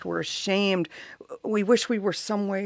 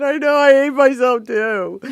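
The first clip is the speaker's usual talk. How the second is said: louder, higher, breaking into tears; tearful outburst; 8 semitones higher, 11 dB louder than usual. Retold or imitated. Mother answering her son through sudden tears, loud and high. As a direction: blurt it out as she starts to cry.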